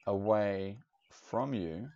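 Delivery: normal talking voice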